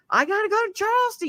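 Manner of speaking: high, fast, frantic whine; panicked. Retold or imitated. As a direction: rush it out in panic. Panicked retelling of herself.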